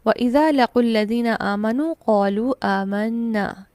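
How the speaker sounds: slow, measured tajweed chanting; Quran recitation. Shown as a demonstration. Teacher recites the verse for students, slow chanted tilawat. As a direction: recite the verse slowly and melodically. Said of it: "आयत number fourteen में हम देखते हैं".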